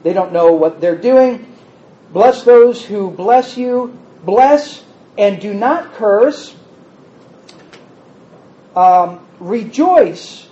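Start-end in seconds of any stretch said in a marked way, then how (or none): 2.1-10.5 slow, deliberate reading of the verse; measured, weighty scripture reading. Shown as a demonstration. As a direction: lay each phrase down slowly for the listeners. Reading scripture slowly, stressing it.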